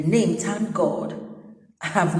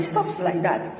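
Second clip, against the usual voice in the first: ordinary talk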